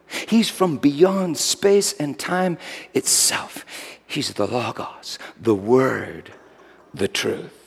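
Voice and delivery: awed whisper. Acted. Awed confession about Jesus.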